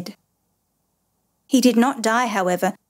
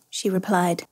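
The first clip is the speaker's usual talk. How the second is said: plain reading voice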